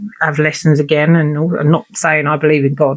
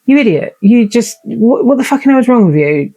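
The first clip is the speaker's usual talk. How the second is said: angry at herself; 5 semitones higher than usual. Retold or imitated. She berates herself mid-panic in a raised, angry voice. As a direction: snap at yourself as if you're the idiot. Scolding herself in her head.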